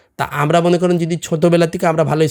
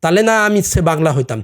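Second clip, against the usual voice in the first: ordinary talk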